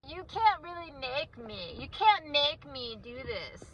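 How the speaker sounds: put-on high, slow, whiny child's protest; mock stubborn-kid voice; defiant kid. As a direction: mimic a kid refusing, drawn out and high. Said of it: "If he's saying".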